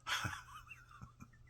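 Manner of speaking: real high wheezy laugh; helpless laugh at one's own story. Real, not acted.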